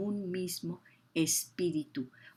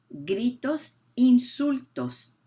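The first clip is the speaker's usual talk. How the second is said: emphatic list; 5 semitones higher, 8 dB louder, slower than usual. Acted. Preacher hammers sin list.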